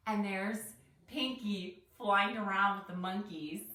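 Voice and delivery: ordinary delivery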